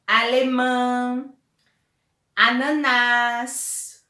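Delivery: word list, each ending on a long held stressed syllable, as in ananás and peru; stress on last syllables. Shown as a demonstration. Teacher gives examples of agudas, each word's last syllable stressed. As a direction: draw out the final syllable of coração, sofá, alemão, ananás, comum, peru, jardim. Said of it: "se a última sílaba ela for acentuada".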